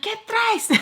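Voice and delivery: imitating relatives asking in Spanish; high mock question. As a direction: toss it out high and suspicious. Family questioning her, acted quote.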